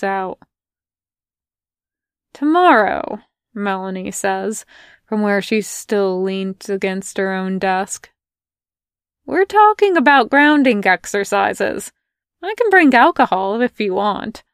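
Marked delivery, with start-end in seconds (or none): 2.3-3.2 lively Melanie. Acted. 9.2-14.4 lively Melanie. Acted. Melanie's voice, raised.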